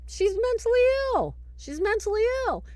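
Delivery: high, sing-song dismissive voice; mocking skeptic. Mocking Michael, who assumes the possessed girl is just ill. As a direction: wave it off like a know-it-all.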